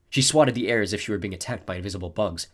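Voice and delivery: ordinary delivery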